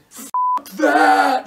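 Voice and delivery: high, angry scream; thrown-out groupie screaming to her friend outside. A narrator's reading. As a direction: spit it out in rage. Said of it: "Cheryl cried".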